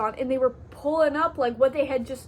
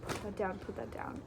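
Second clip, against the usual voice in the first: soft, hushed pleading; silently willing the girl to drop the bag; mock whisper; 3 semitones lower, 11 dB quieter than usual. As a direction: hiss under breath at the other shopper. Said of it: "I'm like".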